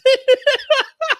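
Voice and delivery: high, squealing laughter; real laughing fit. Real, not acted. Streamer cracking up at his own joke, high laughter. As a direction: burst out laughing.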